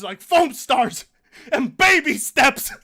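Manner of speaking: usual speaking voice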